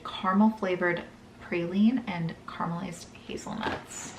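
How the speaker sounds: usual speaking voice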